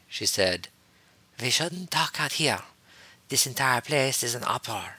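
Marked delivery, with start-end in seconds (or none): 1.4-5.0 higher put-on character voice; furtive female Jedi. A narrator's reading. Jedi Master wary of being overheard, furtive warning. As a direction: pitch it up for a guarded warning.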